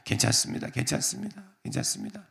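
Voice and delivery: soft, hushed reassurance to the brothers; calm them down gently, almost whispering; gentle reassurance. Acted. Joseph consoling trembling brothers. (떨고 있는 형들을 부드럽게 달래며)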